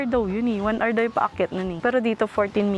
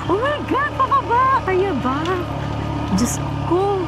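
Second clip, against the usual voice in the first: high, alarmed cries going downhill; panicky, pitch way up; scared; 9 semitones higher, slower than usual. Real, not acted. Scared on steep descent.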